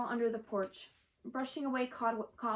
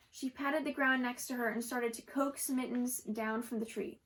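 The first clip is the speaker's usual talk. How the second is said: usual speaking voice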